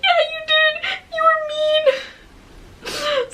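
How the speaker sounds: high, slow tearful whine; sobbing. Retold or imitated. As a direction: drag it out through tears, hurt. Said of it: "I'm sobbing".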